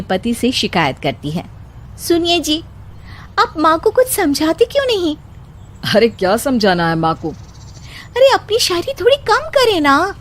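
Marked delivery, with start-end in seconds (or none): 2.1-5.2 put-on higher young bride voice, patiently scolding; higher daughter-in-law warning of ridicule; daughter-in-law, reasoning. Wife complains to husband. 5.9-7.3 a bit lower, quick, dismissive; lightly voiced husband. 8.2-10.1 put-on higher young bride voice, patiently scolding; higher daughter-in-law warning of ridicule; daughter-in-law, reasoning. Wife complains to husband.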